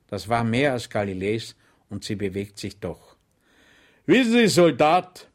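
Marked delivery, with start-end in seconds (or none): 4.0-5.3 higher, louder over-friendly address; friendly sergeant. Acted. Sergeant addressing Schweig sweetly, higher and louder. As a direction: address him with false kindness. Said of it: "ebenso freundlich wie zuvor".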